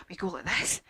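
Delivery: usual speaking voice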